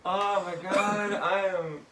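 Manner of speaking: slow, high, loud through laughter; real laughing disbelief. Real, not acted. Reacting to the doctors joke, loud laughing disbelief. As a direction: gasp it out while cracking up. Recovering from big laughing fit.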